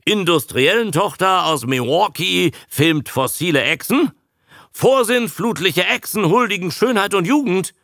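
high, declaimed headline; poetic Abe. A narrator's reading. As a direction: proclaim it with lofty flourish. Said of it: "schlug Abe poetisch vor".

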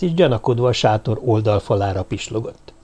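usual speaking voice

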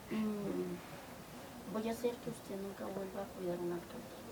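low, soft menacing warning; quiet threat. Retold or imitated. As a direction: threaten him quietly, through the teeth. Threatening the official over bicycle.

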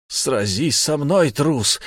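slow, high battle shout at a coward. A narrator's reading. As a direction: roar the challenge. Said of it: "выкрикнул Вейдель".

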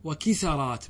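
وكسرات said "wa-kisarāt", a after the s; fatha on the sin. Shown as a demonstration. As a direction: open the s of وكسرات to "sa". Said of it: "والفتح". (وكسرات بفتح السين)

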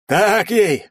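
high, loud triumphant shout; радостный крик. A narrator's reading. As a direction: cheer the hit at full voice. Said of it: "радостно крикнул Карасик". (радостно крикнуть)

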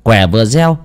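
ordinary delivery

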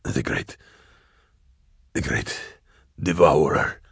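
labor out the words with long gaps; ragged dying voice. A narrator's reading. Dying hunter confessing to Drusha, ragged and weak. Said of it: "his breathing was ragged".

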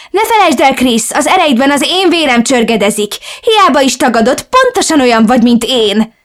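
loud, high wicked shriek; gonosz, üvöltő anya; evil shouting mother. Acted. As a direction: hurl the curse as a gloating villain. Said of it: "üvöltötte anyám egy gonosz kacaj kíséretében".

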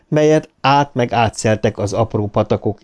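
ordinary delivery

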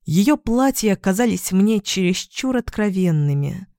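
plain reading voice

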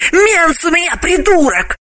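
high woman's voice, blurting it; frank confession. Acted. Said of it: "откровенно призналась Галина".

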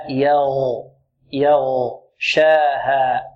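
very slow, low "يغشاها" with light ya and heavy ghayn; correct ghayn articulation. Shown as a demonstration. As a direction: model "يغشاها" slowly, every sound stretched. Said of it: "ولا نقول يغشاها". ("يغشاها" ببطء مع ترقيق الياء وتفخيم الغين)

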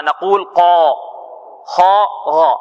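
قا خا غا with a thick, emphatic sound; teacher demo of heavy letters. Shown as a demonstration. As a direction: pronounce قا خا غا full and heavy. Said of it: "كيف ننطقها".

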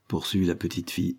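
ordinary delivery, nothing marked

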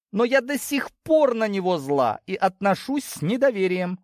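put-on high-pitched irritated young ewe; indignant daughter. Daughter refusing her mother's nonsense, irritated. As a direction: play a cross daughter, pitched well up.

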